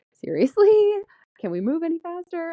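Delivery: high, loud disbelieving seriously; exasperated. Retold or imitated. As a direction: huff it out at the dawdling tellers. Impatient at slow bank tellers.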